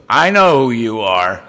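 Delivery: a hint of a deep, amused older man; knowing sponsor.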